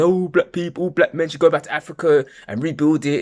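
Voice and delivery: high, loud mock preachy quote; Umar Johnson. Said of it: "you get people like Umar Johnson saying".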